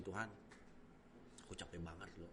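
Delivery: very soft, low confiding to God; low-voiced, intimate plea; quiet personal prayer. Shown as a demonstration. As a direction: demonstrate a hushed, private talk with God. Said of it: "cuma komunikasi".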